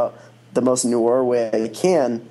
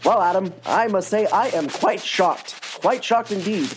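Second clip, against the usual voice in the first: put-on higher, old-fashioned newsreel announcer; old-timey newsy voice; 8 semitones higher than usual. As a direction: play a prim 1950s newscaster, mock shocked. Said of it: "old-timey newsy voices".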